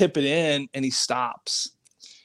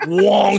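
high, loud drawn-out whoosh; whizzing puck noise; 6 semitones higher, 7 dB louder than usual. Retold or imitated. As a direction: imitate a puck flying past the ear.

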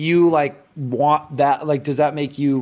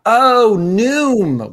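surprised realization; 8 semitones higher, 8 dB louder than usual. Acted. Mock realisation after Zoom mixup.

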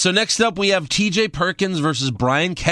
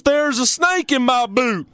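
high-pitched pull-string cowboy impression; imitation of a wrestler's cowboy line; mock toy-cowboy yell; 6 semitones higher than usual. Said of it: "Cass yells".

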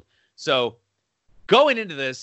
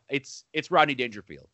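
ordinary talk, nothing marked

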